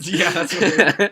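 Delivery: slow words through a laugh. Real, not acted. Laughing at poop question.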